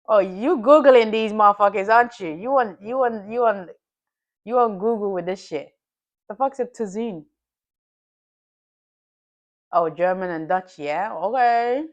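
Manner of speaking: ordinary talk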